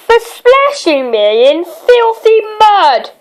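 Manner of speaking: a play-acted angry man, mostly pitch not volume; mild mock roar. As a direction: pretend to roar at her. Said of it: "roared Mister Vexatious".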